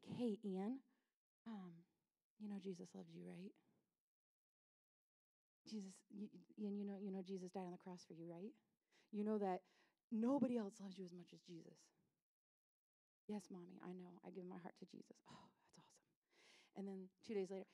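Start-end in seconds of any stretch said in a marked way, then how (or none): 0.0-11.8 soft, low, gentle reminder; tender mother. Acted. Mother tenderly reminding her son. 13.3-15.2 low, soft imitated child reply; dutiful son. Small son dutifully answering mother. 15.3-16.0 whispered, moved response; touched mother. Acted. Mother moved by son's answer.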